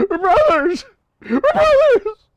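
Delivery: hammed-up high squeal of brotherhood; silly falsetto. Bonding joke with viewer.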